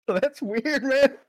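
usual speaking voice

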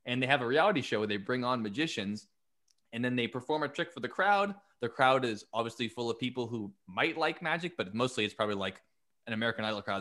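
ordinary talk

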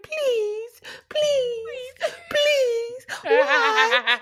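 put-on high, crying whine of a pleading kid; whiny child. Imitating her niece begging, high whiny crying. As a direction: whimper and beg like a little niece. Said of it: "this is whiny voice".

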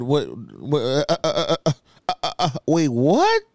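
stuck stammer of mock bewilderment; baffled stammering. Acts out his baffled reaction.

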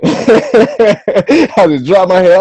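high, loud, laughing retelling. Real, not acted. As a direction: laugh through the words, drawn out. Laughs retelling his dejected reaction.

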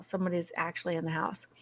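fast, breathless whisper; scared whisper. Retold or imitated. Retelling hearing an intruder, a rushed frightened whisper. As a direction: hush it like someone might hear.

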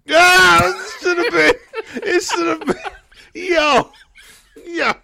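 high, laughing shout of agreement; squealing yes in excitement; excited. Real, not acted. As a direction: yell it out in delight.

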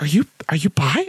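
ordinary delivery

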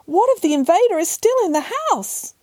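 high, hushed alarm; horrified realisation. A narrator's reading. Mother bear fearing the intruder, alarmed question. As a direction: voice it high, struck by a dreadful thought. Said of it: "had a horrible thought".

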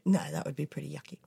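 soft, fast whisper. Real, not acted. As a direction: whisper back playfully. Whisper reply.

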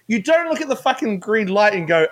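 normal talking voice

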